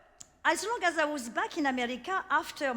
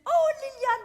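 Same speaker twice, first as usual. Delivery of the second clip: high, louder gushing exclamation; a friend's high, louder excited protest; exclaiming friend; 12 semitones higher, 10 dB louder than usual. Retold or imitated. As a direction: gush like an old friend who won't take no.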